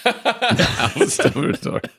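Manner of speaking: high, drawn-out laughing echo. Real, not acted. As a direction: repeat the grand name through laughter. Laughing at the quote's attribution.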